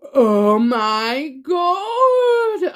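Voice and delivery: big gasp then a slow, loud, high oh my God; genuinely stunned by the card; gasping oh my God in disbelief; shocked gasp. Real, not acted. Tarot reader shocked by card.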